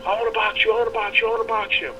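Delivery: high, mocking kid voice, chanting it; mocking teen taunt; whiny kid. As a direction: mimic a bratty teen nagging. Mocking the kid's repeated texts.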